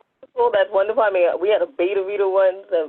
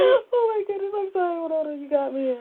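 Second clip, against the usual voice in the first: laughing; 9 semitones higher, 4 dB quieter than usual. Real, not acted. Caught off guard, laughing.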